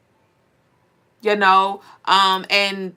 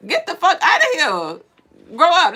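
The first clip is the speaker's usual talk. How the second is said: higher-pitched, punchy scolding; exasperated dismissal; 7 semitones higher, 3 dB louder, slower than usual. Acted. Telling the blame-everyone complainer to get moving, exasperated scolding. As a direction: cut the whiner off with a raised, impatient voice. Snaps back at the mocked complainer.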